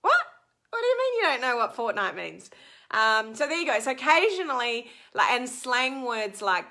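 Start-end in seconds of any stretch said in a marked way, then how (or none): 0.0-2.5 fast, louder disbelieving "what?"; incredulous. Retold or imitated. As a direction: act shocked that they don't know the word. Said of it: "And I'm like".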